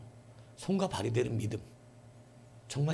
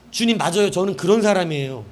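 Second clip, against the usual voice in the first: louder, higher acted admission to the Lord; emphatic humble admission; 5 semitones higher, 9 dB louder than usual. Believer answering the Lord's rebuke, emphatic humble admission. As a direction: agree readily and emphatically, voice raised. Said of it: "관계 맺는 자의 반응은 완전히 다르다는".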